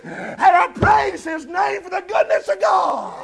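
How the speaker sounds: fiery revival preacher crying out God's goodness; full-force preaching. Acted. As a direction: preach it out to the congregation, shouting praise.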